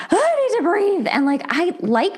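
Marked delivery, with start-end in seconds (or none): 0.1-1.1 exasperated. Retold or imitated. Retold feeling smothered.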